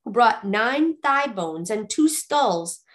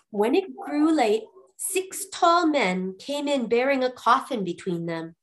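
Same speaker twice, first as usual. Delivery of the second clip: plain reading voice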